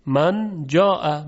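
من جاء slowed, with the نون humming before ج; slow nasal من جاء; hidden nasal n. Shown as a demonstration. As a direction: stretch من جاء through the nose. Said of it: "اخفای نون قبل از جیم".

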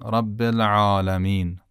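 "العالمین" with a soft throat ayn after the lam. Shown as a demonstration. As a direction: ease into the ayn of "العالمین" without striking it. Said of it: "خیلی نرم". («العالمین» با عین نرم و بدون ضربه)

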